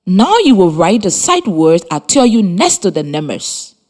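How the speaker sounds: ordinary talk, nothing marked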